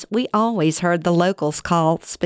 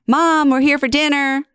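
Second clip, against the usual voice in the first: raised, higher call to Mom through the bedroom door; yelling through a door; 10 semitones higher, 3 dB louder than usual. Retold or imitated. Daughter calling to her mother through a shut door, raised voice. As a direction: call out loudly so she hears behind the door. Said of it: "Shannon yells through the door".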